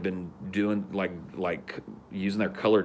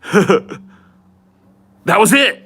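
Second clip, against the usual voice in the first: loud, high, baffled declaration; stunned shout; 10 semitones higher, 13 dB louder, slower than usual. Retold or imitated. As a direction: announce it loudly like it settled everything. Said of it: "he went".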